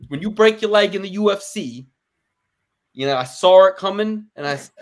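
attempted Long Island accent. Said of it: "It's like".

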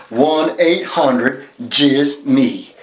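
very slow, drawn-out number read. Acted. Host to viewers, very slow drawn-out number. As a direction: stretch each word out slow. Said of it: "One more time".

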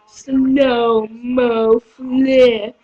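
low, very slow words; mock slow motion. Announcing a slow-mo flip, drawn out low and slow. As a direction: stretch it out like slowed film.

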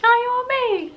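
high, loud gushing squeal; delighted squeal. Real, not acted. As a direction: squeal it like you can't contain yourself. Gushing over blush swatch.

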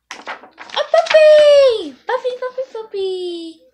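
high, loud pleading cry of "puppy"; a pigeon yelling his wish at the reader; pigeon shouting for a puppy. Acted. Pigeon begs the reader, shouting for a puppy.

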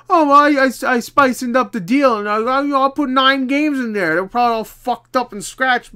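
mock the greedy eBay seller. Collector mocking an overpriced eBay seller, hammed up. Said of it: "I'm just being a dick".

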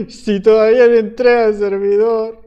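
shouting over a laugh; loud laughing shout. Real, not acted. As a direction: yell it, half laughing. Shouting at the server owner.

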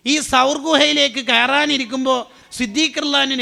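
loud, high, forceful sermon cry; fiery preaching. Acted. Preacher warns the crowd about tainted charity, loud and fiery. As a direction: drive the warning home to the crowd.

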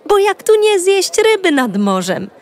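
usual speaking voice

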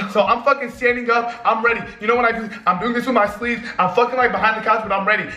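usual speaking voice

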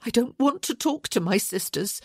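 growing impassioned. A narrator's reading. Woman refusing his advice to see sisters, growing impassioned. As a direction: protest with growing feeling. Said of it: "her voice growing impassioned".

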